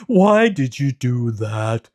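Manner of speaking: slow, loud, hurt lion voice; a big cat whining at the unfairness; complaining lion. A narrator's reading. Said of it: "the lion complained".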